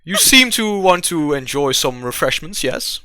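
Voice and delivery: mannered, toned-down innkeeper; changed bartender voice. Acted. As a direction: welcome the guest with exaggerated politeness. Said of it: "I can change that".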